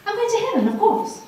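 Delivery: a hint of smug certainty, pitched high; smug confidence. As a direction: lightly mimic her old self-assured pride. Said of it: "sitting there arrogantly thinking".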